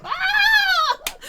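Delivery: high laughing shriek of disbelief. Real, not acted. Shrieking while laughing at a friend's TV moment. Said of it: "I was like".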